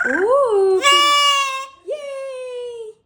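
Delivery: loud high bird cry; screeching parrot voice; parrot squawk. Real, not acted.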